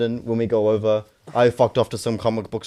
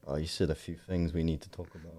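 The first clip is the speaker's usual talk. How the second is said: stern customs officer; 9 semitones lower, 14 dB quieter than usual. Retold or imitated. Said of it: "I'm like".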